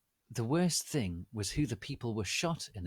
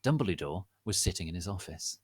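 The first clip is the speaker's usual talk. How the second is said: plain reading voice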